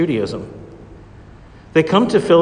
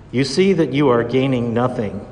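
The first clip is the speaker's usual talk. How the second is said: ordinary delivery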